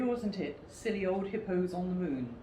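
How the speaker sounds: plain reading voice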